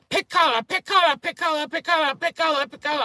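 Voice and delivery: high, loud mock nail salon lady; imitating nail lady. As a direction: rattle it off like a pushy manicurist. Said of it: "the first thing she's doing is".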